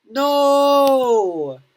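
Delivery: loud, high wail; anguished no. Acted. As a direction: cry out in despair. Kid sees broken Game Boy.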